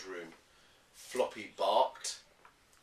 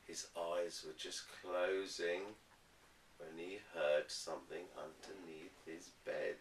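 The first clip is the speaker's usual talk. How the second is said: ordinary delivery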